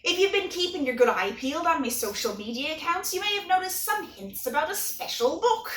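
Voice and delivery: hammy pirate voice, growling arr; cheesy pirate accent. Acted. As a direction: ham up a pirate accent for fun. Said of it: "All kidding and cheesy accents aside".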